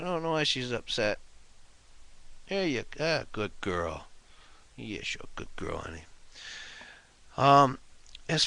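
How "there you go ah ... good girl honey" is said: hushed, gentle coo to a pet; soft cat talk. Real, not acted. Man softly soothing his upset cat.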